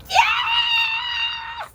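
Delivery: very high excited squeal; high-pitched delighted yell; kid's cheer. Retold or imitated. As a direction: shriek with joy like a little kid. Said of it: "he's like".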